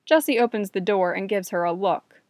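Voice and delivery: ordinary delivery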